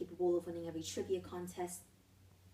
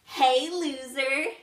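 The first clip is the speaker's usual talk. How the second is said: loud, drawn-out jeer; stretched, shouted taunt; bully's taunt; 5 semitones higher, 12 dB louder, much slower than usual. Acted. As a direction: call it out like a schoolyard bully, higher and slow. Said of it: "Max sauntered over and threw out".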